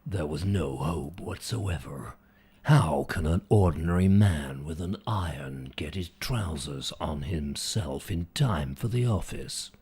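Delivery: deep, portentous movie-trailer growl; deep-voiced film trailer man. Shown as a demonstration. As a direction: make the ordinary sound extraordinary. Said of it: "the deep-voiced man always".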